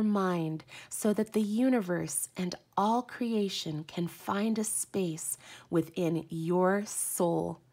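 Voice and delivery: loud, proclaiming oracle-card read; a spiritual reader proclaiming the card's words to viewers. Acted. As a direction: declaim the card's message like a revelation. Reads the card text aloud.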